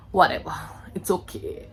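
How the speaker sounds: dismissive whisper; whispered aside. Real, not acted. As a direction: breathe it away under your breath. Brushing off identity crisis.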